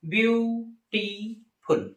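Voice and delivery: Beautiful stretched out very slowly, each syllable apart; slow syllable-by-syllable demo. Shown as a demonstration. Teacher breaks beautiful into syllables for students, very slowly. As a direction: spell out beautiful slowly so the taps are heard. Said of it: "try करिए बोल के".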